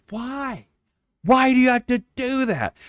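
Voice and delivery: high pleading whine at dad; exasperated whine. Retold or imitated. Child at dinner irritated by dad's chewing, high whine. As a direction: whine it up high, annoyed kid. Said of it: "I'm just like".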